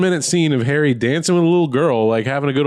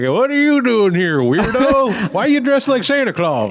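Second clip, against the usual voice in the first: put-on old security guard; 9 semitones higher than usual. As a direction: squeak it out like a startled ancient guard. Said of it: "Wanders up and he's like".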